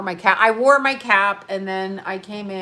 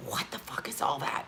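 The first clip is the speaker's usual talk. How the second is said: hushed, breathy whisper, much softer; disgusted whisper; 7 semitones lower, 12 dB quieter than usual. Real, not acted. Ranting to viewers about food, a disgusted whisper. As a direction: whisper it in disbelief.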